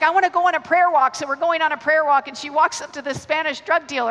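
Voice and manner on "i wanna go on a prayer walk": eager friend. Retold or imitated. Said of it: "And she's like".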